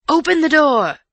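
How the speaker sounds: high, furious shouted demand; loud angry shout. A narrator's reading. Man shouting at the locked door, loud and angry. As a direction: shout angrily to be let in. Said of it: "loud, angry".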